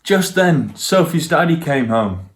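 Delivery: ordinary delivery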